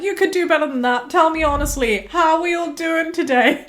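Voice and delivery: high, loud stage patter; drag queen hyping the crowd. Retold or imitated. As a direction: egg the audience on like a host. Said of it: "And she's like".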